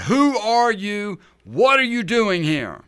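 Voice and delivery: loud, slow, high bellow; soldier roars. Acted. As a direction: roar the challenge a second time. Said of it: "the Roman soldier roars out".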